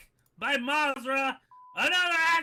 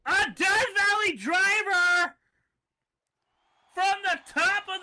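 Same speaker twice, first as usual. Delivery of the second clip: big excited call; excited shout; 5 semitones higher, 5 dB louder than usual. Real, not acted. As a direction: hype the move, shouting. Calling a big wrestling move.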